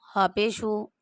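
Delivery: ordinary talk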